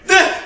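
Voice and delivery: sharp high yelp; startled yelp. Acted. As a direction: yelp in surprise. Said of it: "you're like".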